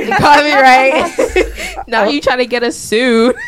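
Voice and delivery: laughing. Real, not acted. Laughing hard at the joke.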